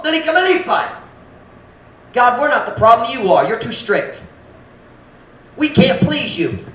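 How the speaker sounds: high, loud resentful accusation; resentful complaint. Acted. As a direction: accuse God of being too strict. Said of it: "That's what they're saying".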